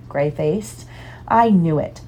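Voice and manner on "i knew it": usual speaking voice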